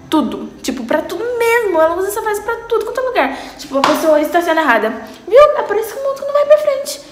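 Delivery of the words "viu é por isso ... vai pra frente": high exasperated mom scolding with a laugh; imitating mom. Nicole's mother scolds a badly parked driver, exasperated. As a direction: play a mother fed up with the world. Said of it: "Ela fala desse jeito".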